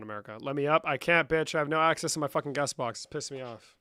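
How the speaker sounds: ordinary delivery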